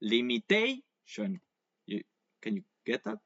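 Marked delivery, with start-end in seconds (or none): 0.0-1.4 limitation slowed with the weight on TA; stress on TA. Shown as a demonstration. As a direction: lean on TA in limitation. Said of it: "just before the shon is where you place the accent".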